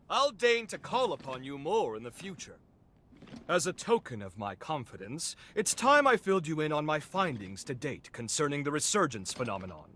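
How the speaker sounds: usual speaking voice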